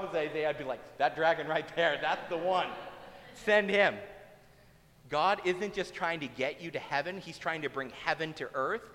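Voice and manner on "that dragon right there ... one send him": loud, insistent pointing-out; mock-urgent loud recommendation; urgent nomination. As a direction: push the choice loudly and firmly. Imagined Isaiah eagerly nominating the dragon.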